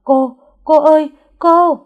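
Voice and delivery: frantic high shout for help; panicked cry. Acted. Maid shouting in panic for the lady of the house. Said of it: "tiếng con Lâm đầy hốt hoảng".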